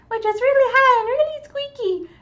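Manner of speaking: high squeaky little creature; tiny character voice. Shown as a demonstration. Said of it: "I have a voice for a little character".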